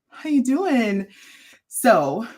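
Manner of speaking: ordinary talk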